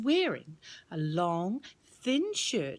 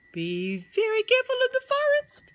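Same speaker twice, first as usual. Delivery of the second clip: much higher, tiny bird voice; little robin; 6 dB louder than usual. Acted. Robin in a tree warning the children, high and chirpy. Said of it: "said a little robin".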